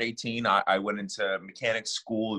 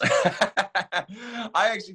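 laughing; 10 semitones higher, 3 dB louder, much slower than usual. Real, not acted. Laughs while starting an answer.

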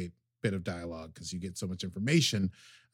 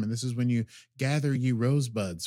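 ordinary delivery

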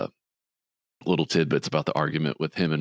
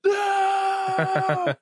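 high, drawn-out no; mock scream. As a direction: wail the no like a heartbroken man. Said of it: "Peter just screams".

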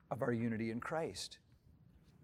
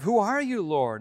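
Saul asking; 5 semitones higher, 7 dB louder than usual. Retold or imitated. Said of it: "he asks in verse five".

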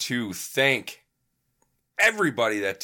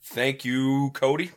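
upbeat, higher host outro; podcast sign-off; 4 semitones higher than usual. Acted. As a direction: wrap up the show brightly. Host signs off the show.

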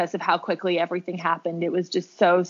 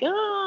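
high pained groan; 12 semitones higher than usual. Retold or imitated. Reaction to being called lucky.

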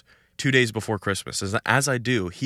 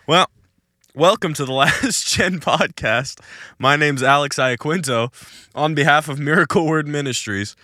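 podcast host intro; 6 semitones higher, 7 dB louder than usual. Acted. Host welcomes listeners to the podcast, announcer-style. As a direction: open the show with energy.

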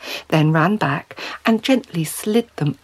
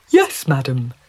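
low-pitched polite fish voice; obliging fish character; courteous talking trout; 4 semitones lower, 3 dB louder than usual. Acted. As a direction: agree as a deferential trout.

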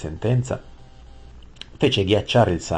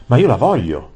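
high, loud demanding cry; shouting; 6 semitones higher, 9 dB louder than usual. A narrator's reading. Prince demands the queen from his father, shouting. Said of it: "gridò il principe".

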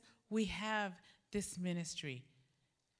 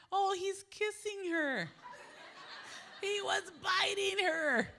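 amused disbelief; 11 semitones higher, 4 dB louder than usual. Retold or imitated. Mistaking biting for kissing.

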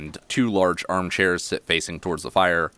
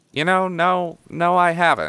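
put-on high, musing kid voice; inquisitive halfling boy; 11 semitones higher, 4 dB louder than usual. Halfling child considering the tunnel idea, inquisitive and high. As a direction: pitch it up, pondering a new idea. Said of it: "looks sort of inquisitive and goes".

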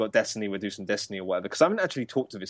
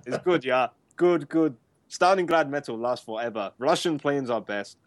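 put-on Russian accent; 4 semitones higher than usual. Continues Russian boast bit.

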